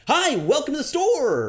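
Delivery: hammed-up retail greeting; fake-cheery shop worker. Shown as a demonstration. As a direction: sing it out like a bored clerk faking cheer. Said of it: "someone who has a shitty job".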